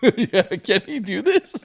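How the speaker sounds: put-on high, slow, laughing kid's wail; a hammed-up child's worried plea; mock distress. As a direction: play a bewildered child, half laughing. Kids panicking over no cake.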